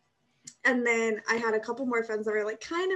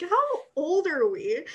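incredulous; 5 semitones higher, 5 dB louder, slower than usual. Retold or imitated. Stunned by eighth grade gossip.

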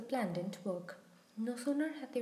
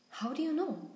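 higher, louder burst of surprise; startled splutter; 6 semitones higher, 5 dB louder than usual. A narrator's reading. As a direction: splutter it out, caught completely off guard. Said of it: "she spluttered".

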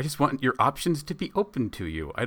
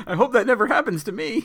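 high-pitched, through laughter; giggly, hammed-up worry; laughing mock dread; 13 semitones higher, 4 dB louder, faster than usual. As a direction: join in the horror while cracking up. Laughing along with mock horror.

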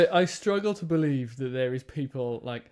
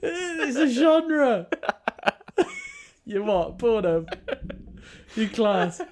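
high, slow, laughing almost crying; wheezing laughter through words; laughing hard; 12 semitones higher, much slower than usual. Real, not acted.

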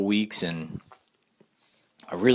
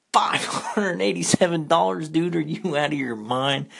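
high-pitched disbelief, re-enacted; incredulous outburst; 9 semitones higher than usual. Customer to seafood seller over the price, high incredulous outburst. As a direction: throw the price back in shock.

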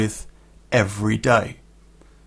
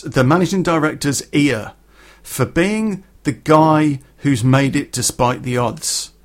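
ordinary talk, nothing marked

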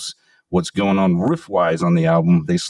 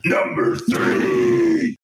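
slow, drawn-out high countdown call; dramatic announcer; much slower than usual. Acted. Host announcing the next countdown pick, dramatic announcer call.

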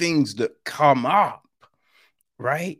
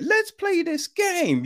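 sing-song invite. Retold or imitated. Quoting film's creepy invitation.

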